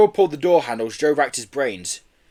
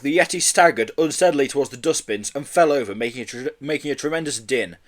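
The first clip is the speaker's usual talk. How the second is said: ordinary delivery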